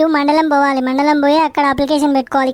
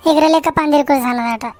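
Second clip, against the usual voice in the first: usual speaking voice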